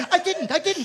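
high-pitched flustered denial; Hugh Grant. Retold or imitated. Fellow nominee denying he brought a bag, high mock protest. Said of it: "He's going on Hugh Grant going".

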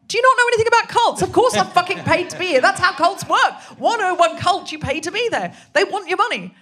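high, loud, fast exasperated shout; panicked retort. Retold or imitated. Comedian shouting back at her conscience, panicked. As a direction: yell back at your own conscience in panic. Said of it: "And I was like".

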